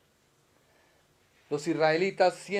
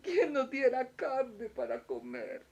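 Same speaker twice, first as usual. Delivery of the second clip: mock crying; 6 semitones higher, 5 dB quieter than usual. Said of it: "se pusieron a llorar y a decir".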